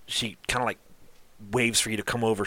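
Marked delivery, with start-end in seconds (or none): none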